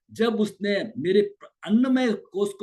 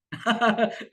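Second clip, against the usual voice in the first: light amused chuckle; a quick easy laugh; short laugh; 6 semitones higher than usual. Retold or imitated. Calm mind laughing off the insult.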